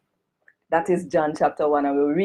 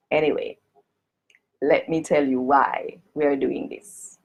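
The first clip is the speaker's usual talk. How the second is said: ordinary talk, nothing marked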